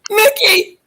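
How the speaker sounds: very high, loud cry of the name; mock yell. Bobcat yelling about his flooded watch, very high loud mock yell. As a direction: howl over the ruined watch. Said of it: "he yells".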